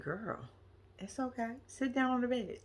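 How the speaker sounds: very quiet, soothing aside; soft reassurance. Pretend. Storyteller soothing woman winded from the stairs, reassuring. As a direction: drop right down and gently calm her.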